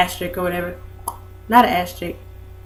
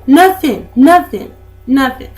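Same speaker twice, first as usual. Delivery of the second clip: louder, higher, each nothing falling; exasperated repeat; 4 semitones higher, 7 dB louder, slower than usual. Real, not acted. Vlogger telling viewers he never answered, exasperated. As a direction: hammer home the silence, fading out. No reply from boyfriend.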